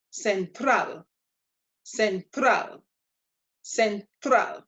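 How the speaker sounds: "central" as sen-TRAHL, Spanish open a; Spanish "central", slight break, then stressed TRAHL; stress last syllable. Shown as a demonstration. Teacher repeats for learners, "central" stressed sen-TRAHL. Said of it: "stress is going to be on the last".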